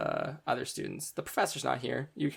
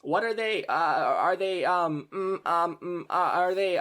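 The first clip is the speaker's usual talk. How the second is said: put-on flustered girl; 6 semitones higher, 7 dB louder, slower than usual.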